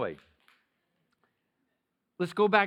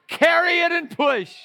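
loud high preaching cry; ringing preacher shout; shouted command; 12 semitones higher, 12 dB louder than usual. Acted. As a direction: call it out to the church.

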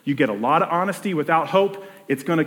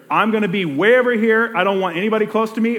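higher, louder, quick mock keep-away; louder imitation of someone keeping people at arm's length; defensive push away; 6 semitones higher, 5 dB louder than usual. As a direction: fend everyone off, half-acted. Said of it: "It's either".

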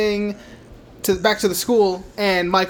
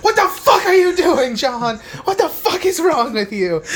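much higher, loud hammed-up shout at her son; mock furious yell; 9 semitones higher, 3 dB louder than usual. Mother yelling at her son for sneaking out, high and loud. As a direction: scream it at him like an unhinged mom, laughing.

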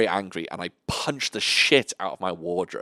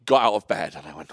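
normal talking voice

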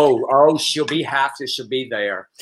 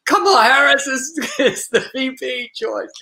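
re-enacted excited outburst, pitched way up; excited blurted announcement; 13 semitones higher than usual. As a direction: blurt out breaking news in a high, thrilled voice. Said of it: "And I'm like".

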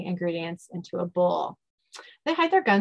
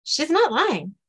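small, raised little voice; high-pitched voice; 7 semitones higher, slower than usual. Acted. Second little captive backing the first, high-pitched. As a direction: back up a friend in a higher voice. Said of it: "Another high-pitched voice says".

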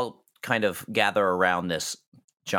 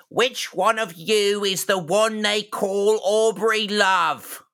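high, drawn-out orc proclamation; orc leader; 12 semitones higher, slower than usual. Acted. Orc demanding to find Aubrey, high drawn-out creature voice. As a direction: call them out as a commanding orc. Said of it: "an orc, says".

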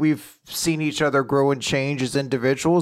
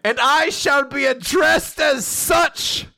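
high, slow, booming proclamation; booming arrogant alien overlord; 11 semitones higher, slower than usual. Pretend. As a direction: bellow it like a self-crowned tyrant. Proclaims himself lord of Scotland.

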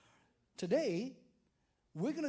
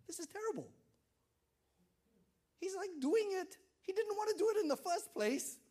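much higher mock whine; whiny complaint; 11 semitones higher, 3 dB louder, faster than usual. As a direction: complain like a put-out listener. Mocking listener's complaint.

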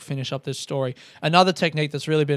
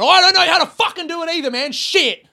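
very high, loud, fast hammed-up rant; imagined teacher losing it completely; angry meditation guide; 13 semitones higher, 7 dB louder than usual. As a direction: scream in helpless mock frustration. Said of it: "I want him to be a little bit angry".

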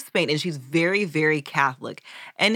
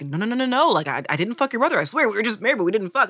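high, loud, fast protest; panicked mock pleading; frantic denial; 5 semitones higher, 4 dB louder, faster than usual. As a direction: insist desperately that nothing happened. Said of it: "Catherine of Aragon is like".

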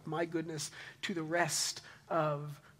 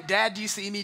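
mild mock yell; 4 semitones higher, 7 dB louder than usual. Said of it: "she keeps yelling".

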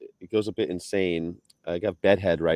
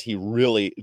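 normal talking voice

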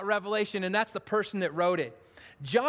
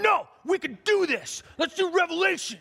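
high, insistent Jim voice; imitating Jim; 7 semitones higher than usual. Pastor imitating Jim insisting on the series. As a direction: push back like Jim, determined. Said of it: "He's going".

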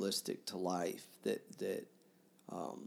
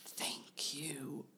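softer, breathy whispered thanks; sighing whisper; 4 semitones higher, 10 dB quieter than usual. Acted. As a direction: breathe it out like a sigh after fainting. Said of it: "he uttered slowly, as if sighing after a swoon".

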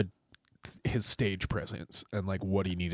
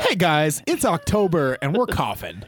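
bright, louder vlogger greeting; upbeat host voice; 7 semitones higher, 7 dB louder than usual. Pretend. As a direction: announce it like a chirpy YouTuber. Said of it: "Good joke".

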